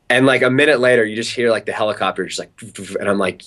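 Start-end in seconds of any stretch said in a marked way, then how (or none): none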